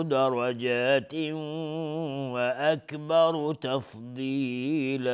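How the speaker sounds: slow, high, loud melodic chant; Quran recitation. Acted. Teacher reciting the new verse before explaining it. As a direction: intone the verse in full tartil.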